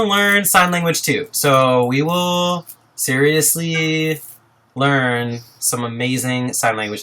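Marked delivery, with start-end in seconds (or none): none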